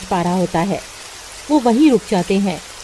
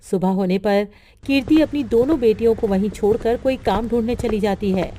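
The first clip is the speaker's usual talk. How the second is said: plain reading voice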